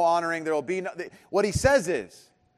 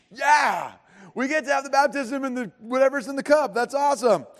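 higher, eager and clueless; naive excitement; 6 semitones higher, 4 dB louder than usual. Retold or imitated. Disciples imagining the baptism, mock eager gushing. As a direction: gush like a disciple who missed the point. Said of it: "they're like".

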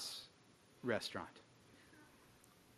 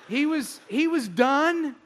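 higher, louder mock indignation; loud exasperated storytelling; indignant rant; 10 semitones higher, 7 dB louder than usual. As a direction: complain in comic outrage.

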